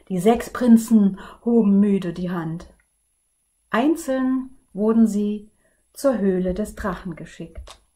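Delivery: put-on lively read-aloud for children; storybook narration. A narrator's reading. Librarian reading a fairy tale to children, lively and clear. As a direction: tell the tale to the kids with energy.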